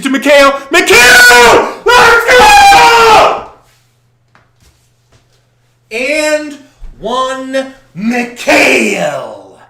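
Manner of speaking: high, loud euphoric screaming. Real, not acted. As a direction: scream for the and-one play. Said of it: "Sorry for the screaming".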